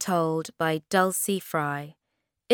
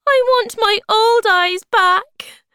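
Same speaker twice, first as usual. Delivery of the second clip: very high, loud, upset wail; shrieked plea from a storybook maiden; distressed cry; 11 dB louder than usual. A narrator's reading. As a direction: wail it like a distraught little creature. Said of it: "she cried".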